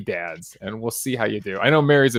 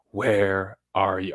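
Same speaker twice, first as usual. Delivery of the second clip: imitating a gravelly audiobook voice, low and very slow; drawn-out low gravelly imitation; gravelly Mitt; 5 semitones lower, 4 dB quieter, much slower than usual. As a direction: lean lightly into a slow, low gravelly growl. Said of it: "gets really gravelly".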